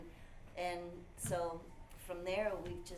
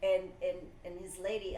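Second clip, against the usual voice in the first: ordinary talk, nothing marked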